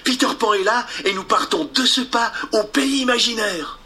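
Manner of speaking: voix de fillette, plus aiguë; réveiller ses frères avec excitation; fillette excitée. Acted. (Wendy excitée)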